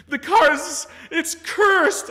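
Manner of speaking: high, loud mock wail; hysterical crying. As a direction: wail like a hysterical customer, hammed-up. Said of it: "sixty-five-year-old woman crying".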